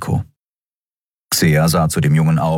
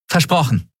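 put-on higher, quick, impatient young captain; Endriel, brisk captain; 11 semitones higher than usual. A narrator's reading. Captain Endriel urges the crew to move on, higher and quick. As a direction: hurry them along, half joking.

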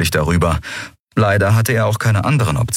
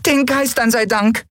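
relieved little Yadi. Acted.

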